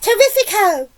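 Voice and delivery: high, loud gleeful cry; excited call. A narrator's reading. Aunt cheers the giant peach, excited call. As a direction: call it out with relish. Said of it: "Aunt Sponge called out".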